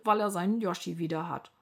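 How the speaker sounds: ordinary delivery, nothing marked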